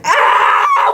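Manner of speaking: sudden very high shriek; piercing, high "ah"; high-pitched scream. Retold or imitated. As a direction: cry out in shock, way up high. Reacting to the kiss, retold shock.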